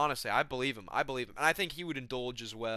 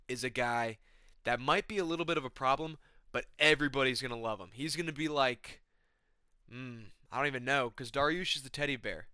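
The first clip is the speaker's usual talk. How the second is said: ordinary talk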